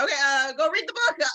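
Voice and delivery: high, harried mock-mom brush-off; frazzled mom. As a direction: shoo the kids off in a high, fed-up voice. Frazzled mom sending kids off.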